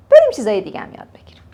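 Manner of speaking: fast playful whisper. Real, not acted. Teacher whispering to students. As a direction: whisper it quickly as a secret aside.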